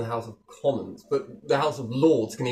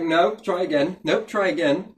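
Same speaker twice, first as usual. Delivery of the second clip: loud, higher mock machine refusal; mock AI rejection; 4 semitones higher, 6 dB louder, slower than usual. AI refusing normal speech, loud mechanical nope. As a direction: repeat it like a stubborn automated system.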